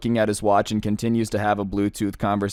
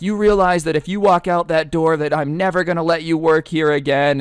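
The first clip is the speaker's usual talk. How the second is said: mock indignant boss; 6 semitones higher, 4 dB louder than usual. Acting the boss threatening Dan.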